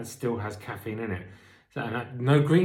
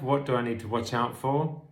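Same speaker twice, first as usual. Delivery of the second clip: normal talking voice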